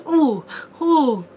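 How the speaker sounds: loud delighted ooh; excited ooh. Real, not acted. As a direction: squeal with delight. Reacting with delight.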